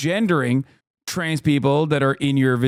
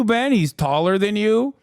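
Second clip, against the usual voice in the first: ordinary talk, nothing marked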